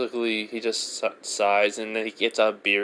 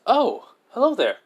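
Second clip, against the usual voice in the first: high, slow, polite greeting; a clerk welcoming strangers politely; polite guild girl; 13 semitones higher, 5 dB louder than usual. Acted. Edna greeting newcomers.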